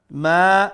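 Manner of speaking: loud মা with a long a; one-alif lengthening. Shown as a demonstration. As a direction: stretch the vowel of মা. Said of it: "এক আলিফ লম্বা".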